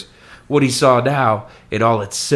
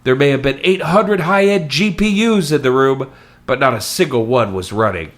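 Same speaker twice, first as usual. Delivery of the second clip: ordinary delivery, nothing marked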